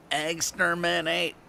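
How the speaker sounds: high, slow Dalek voice at normal level; lightly voiced Dalek repeat, trailing off; a hint of the Dalek, slow and high. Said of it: "And it was a Dalek".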